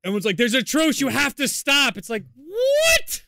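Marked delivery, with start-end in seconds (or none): 0.4-2.0 high, loud retold plea; mock urgency. Players imitated telling others to stop, urgent. 2.3-3.2 very high, loud mock "what"; incredulous. Player imitated hearing of the truce, incredulous. Retold disbelief at truce demand.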